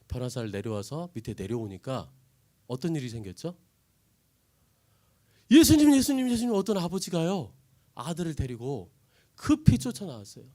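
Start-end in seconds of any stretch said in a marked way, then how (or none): 5.5-6.5 high, loud repeated calls; desperate calling. Acted. Father running to Jesus, calling out loud. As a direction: shout to catch his attention.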